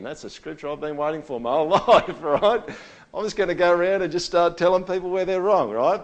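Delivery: mock gleeful eagerness. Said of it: "Some of you going".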